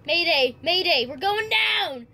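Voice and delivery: loud, slow mayday call; bracing for a crash. Pretend. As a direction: shout the distress call. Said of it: "role play that we're crashing".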